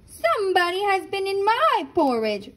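a lightly voiced offended Mama Bear; Mama Bear indignant. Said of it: "said Mama Bear".

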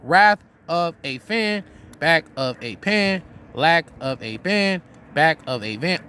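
wrath of a fan, back of a pan, said slow with each beat marked; slow demonstration of matching syllables; slow rhythmic recital. Shown as a demonstration. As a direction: lay out the rhyming syllables slowly, beat by beat. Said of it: "Listen, check it out".

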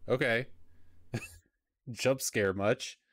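ordinary delivery

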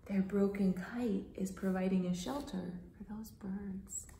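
fast unvoiced whisper. Teacher whispering the ending to kids. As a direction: tell it as a gentle secret.

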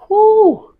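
high hype whoop; excited whoop. Real, not acted. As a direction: let out a big woo. Hyped gym shout.

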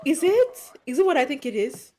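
blurting out the question with sudden excitement; excited. Real, not acted. Recognizing the movie's big moment.

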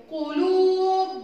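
قلوب slow and high with a held "luu"; drawn-out قلوب; long vowel. Shown as a demonstration. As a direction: chant قلوب, waw stretched. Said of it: "طويلة".

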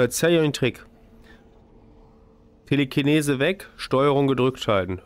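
ordinary talk

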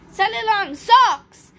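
amused roar. A narrator's reading. Miss Fizz repeats Cosmo's wrong magic word, amused roar. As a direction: roar the silly words back, hammed-up. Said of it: "Miss Fizz roared with laughter".

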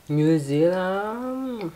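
very slow, stretched reading of the name; drawn-out name. Real, not acted. Streamer reading the costume's country aloud, slow and drawn out. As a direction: savor each syllable as you read it.

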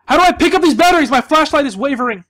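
loud, high-pitched real panic; panicked. Real, not acted. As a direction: cry out in alarm as the light fails. Panicking as flashlight fails in game.

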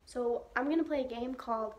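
normal talking voice

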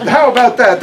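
a tough, no-nonsense patient giving orders; Harmony's voice. A narrator's reading. Harmony delighted.

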